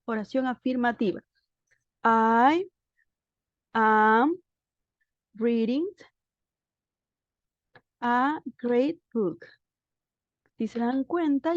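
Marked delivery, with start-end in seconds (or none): 2.0-9.5 each word set apart with long pauses; slow, careful model sentence; slow dictation. Shown as a demonstration. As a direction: spell it out slowly for the class. Teacher models the answer sentence.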